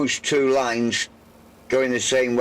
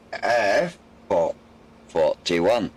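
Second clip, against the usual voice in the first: bingo caller; 5 semitones lower, much slower than usual. Real, not acted. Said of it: "I sounded like a bingo caller then".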